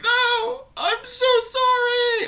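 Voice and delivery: very high puppet-like apology; slow falsetto mock pleading; mock grovelling. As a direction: squeak out a drawn-out, hammed-up sorry. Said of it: "start with the puppet show there".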